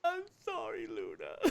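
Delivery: high-pitched, hammed-up sobbing apology; crying. She apologises to Luna for the horror, crying. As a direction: wail it, breaking into acted sobs. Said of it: "She just starts crying".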